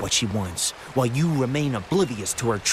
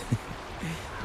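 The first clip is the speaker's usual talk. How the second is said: mocking giggle; 5 semitones higher, 10 dB quieter than usual. Gossip mocking the counselor.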